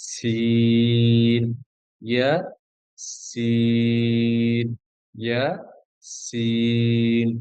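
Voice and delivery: সিন drawn out very long and slow, ইয়া kept short; long pull on letter names. Shown as a demonstration. Teacher reciting Arabic letters to students, সিন held very long. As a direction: stretch সিন to its full length, clip ইয়া. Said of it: "যেটা লম্বা টান, সেটা লম্বা টান দিব".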